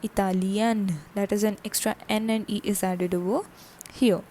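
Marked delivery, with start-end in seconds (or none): 0.0-1.0 "Italian" as ee-ta-lyan, final n sounded; feminine French pronunciation. Shown as a demonstration. Teacher showing the feminine form, "Italian" ending in a sounded n. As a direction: close "Italian" on a clear n. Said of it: "For femina, it becomes".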